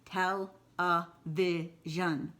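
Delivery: "Television" drawn out slowly, tel-e-vi-sion, four clear beats; slowed to syllables. Shown as a demonstration. Teacher drags the word out for the class to count, slowly. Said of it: "moves differently from syllable to syllable".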